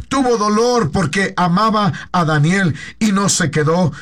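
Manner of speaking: ordinary talk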